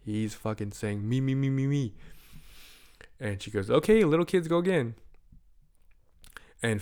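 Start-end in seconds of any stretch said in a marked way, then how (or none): 1.1-1.9 put-on a little kid pleading to go next; eager kid. Kid volunteering at piñata, eager pleading. 3.7-5.0 loud announcing call. Retold or imitated. Host calling to the kids, loud call.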